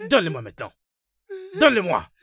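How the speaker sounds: very loud order, climbing high; furious shout. Acted. Father demanding the phone at once, furious shout. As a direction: build to a full angry yell.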